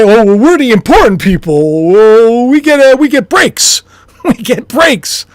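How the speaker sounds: mock the privileged rich, lightly put on; smug rich people. Imitating jet owners to viewers, lightly put-on smug bigwig voice. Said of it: "do you think they're gonna say".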